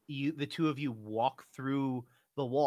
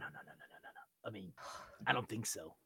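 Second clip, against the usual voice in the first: normal talking voice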